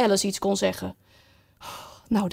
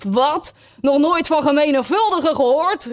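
higher-pitched, incredulous outburst; astonished exclamation; 6 semitones higher, 3 dB louder than usual. A narrator's reading. Griffin amazed at Alice's ignorance, astonished exclamation. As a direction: echo it back in amazed disbelief. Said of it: "hief zijn beide poten vol verbazing op".

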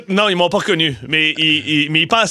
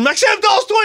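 high shouted call down the hall; excited shout. Retold or imitated. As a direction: yell his name to get his attention. Calling out to coworker.

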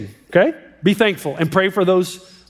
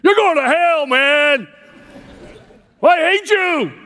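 hammed-up preacher's imitation of a screamer; mock scream; 7 semitones higher, slower than usual. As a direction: pretend shout at the possessed man. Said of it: "did he just scream at him".

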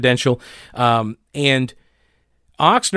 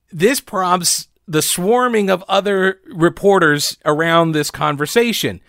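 ordinary delivery

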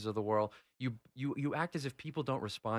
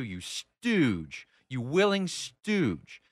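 scornful insult; 3 semitones higher, 4 dB louder, much slower than usual. Real, not acted. Mock-insulting an absent opponent on air.

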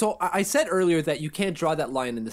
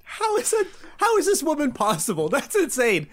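high-pitched laughing amazement; incredulous, amused outburst; amazed disbelief; 9 semitones higher than usual. Real, not acted. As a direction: marvel at it, voice jumping up high. Amazed reaction to margarita story.

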